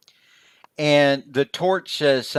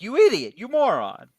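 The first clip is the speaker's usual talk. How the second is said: higher-pitched exasperated insult; mock scolding; 7 semitones higher, 3 dB louder than usual. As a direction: chew out a fool in mock anger. Scolding the Torch character.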